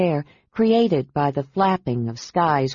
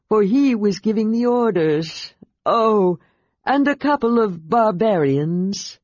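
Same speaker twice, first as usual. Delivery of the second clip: steady report in the dragon's own voice; the dragon lists the fugitives for his rider; 3 semitones higher than usual. A narrator's reading. Sky reports who escaped.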